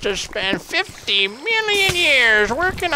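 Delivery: put-on slow, high, creaky old-timer voice; very old man. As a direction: play a doddering grandpa reminiscing. Old-timer reminiscing in Minecraft.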